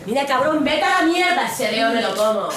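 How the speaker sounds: loud, high telling-off; angry retort. Retold or imitated. Woman curses at a catcaller, loud high retort. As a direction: curse him out, fed up. Retold insults to harasser.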